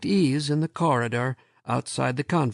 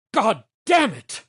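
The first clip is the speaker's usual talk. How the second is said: loud, high curse of rage; furious shout; 9 semitones higher, 6 dB louder than usual. A narrator's reading. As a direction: explode at him. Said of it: "Lomax shouted".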